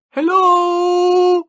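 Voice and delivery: a high, loud hail from far down the beach; distant call. A narrator's reading. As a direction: shout hello across the sand. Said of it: "broken by a distant call".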